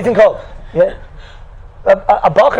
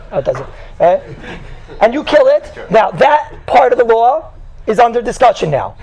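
ordinary talk, nothing marked

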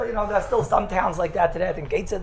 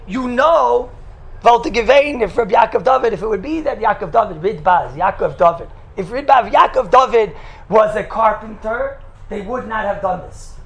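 loud, raised-pitch rabbinic proclamation; impassioned public declaration; 3 semitones higher, 5 dB louder than usual. Retold or imitated. Rabbi addressing God at the open ark, loud impassioned declaration. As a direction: declaim it forcefully to Heaven.

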